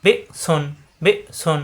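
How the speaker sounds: বি cut off in the throat, a tiny gap, then সুন; abrupt stop after বি, then সুন; hard glottal stop. Shown as a demonstration. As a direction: catch বি in the throat before সুন. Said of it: "গলার ভিতরে আটকে যাবে".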